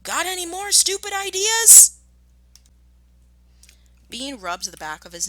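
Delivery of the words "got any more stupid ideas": much higher, loud mocking shout in another voice. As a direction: yell it at the deaf man. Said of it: "Bounce shouted back".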